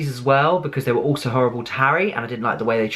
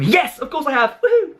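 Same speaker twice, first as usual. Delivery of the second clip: really hyper; 13 semitones higher than usual. Real, not acted. Fan answering a Twitter question, hyper and squealing. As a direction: burst out in giddy fan joy. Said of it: "that was really hyper".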